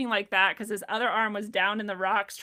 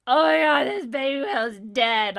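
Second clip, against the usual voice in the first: loud, higher-pitched mock wail; retold bawling; 4 semitones higher, 8 dB louder than usual. Woman alone on beach finds dead baby whale, imitated bawling. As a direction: act out bursting into tears, loudly. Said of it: "I just started bawling".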